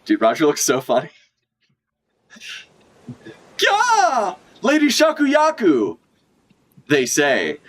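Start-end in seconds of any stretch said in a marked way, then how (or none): 3.6-6.0 high, hammed-up shocked pirate cry; mock startled pirate. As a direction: yelp her name like a panicked pirate.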